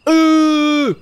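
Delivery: loud, high, held "eh"; high drawn-out squeal. Real, not acted. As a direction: squeal a long hesitant sound up high. Hesitates aloud over a choice. (hoge, langgerekte twijfelkreet)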